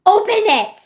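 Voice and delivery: loud, high yell; reenacted frantic yell; mock shout. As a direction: shout the order at him. Reenacted demand at car door.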